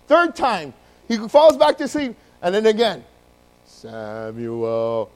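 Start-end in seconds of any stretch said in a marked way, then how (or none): 3.7-5.1 low, drawn-out booming God calling a name. Acted. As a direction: intone the name slowly and deep. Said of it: "That's my God voice".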